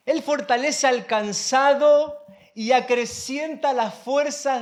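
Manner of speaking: slow, measured phrases from the pulpit; preacher reading aloud with weight; emphatic scripture reading. Acted. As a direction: proclaim the verse, pausing between phrases. Reads scripture to congregation.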